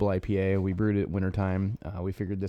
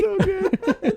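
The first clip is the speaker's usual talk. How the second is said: laughing; 6 dB louder than usual. Real, not acted. Reacting to the arrow story.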